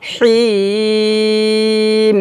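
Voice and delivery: "হিম" com o ই muito esticado em nota firme; vogal triplamente longa. Shown as a demonstration. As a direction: segure o ই de "হিম" e só depois feche no ম. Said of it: "তিন আলিফ টান দিয়ে তারপর মিমকে ধরবে".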